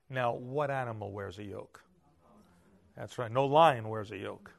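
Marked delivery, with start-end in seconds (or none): none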